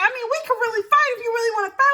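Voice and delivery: high, very fast imitated threat; combative retort. Bouncer offering to fight the women, combative. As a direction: rattle off a mock fight offer.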